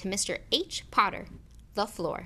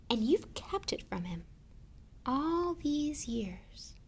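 hushed, slowing, disbelieving fury; contained rage; 6 semitones higher, 3 dB quieter, slower than usual. A narrator's reading. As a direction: breathe out the accusation slowly. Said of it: "every syllable trembled with rage".

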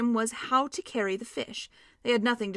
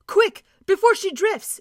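loud, higher, slow urgent shout; Peter's shout; 10 semitones higher, 10 dB louder than usual. A narrator's reading. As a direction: yell to the others to hurry. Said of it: "shouted Peter".

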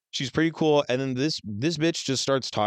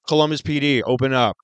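loud police command through the door; police shout; 8 dB louder than usual. Retold or imitated. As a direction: bang on the door and order them out.

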